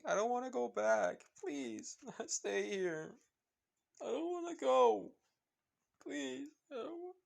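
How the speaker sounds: put-on crying little girl. A narrator's reading. Eri clinging to Izuku, crying and begging to stay. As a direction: beg him through tears not to be sent back. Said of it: "she just keeps crying".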